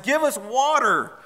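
high, drawn-out mock demand; quarrelsome demand. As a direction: act out a slow, insistent complaint. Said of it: "they quarreled with Moses".

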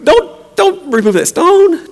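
very high, comic pleading; Martha. Acted. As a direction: beg in a squeaky mock-alarmed voice. Comic plea as Martha continues.

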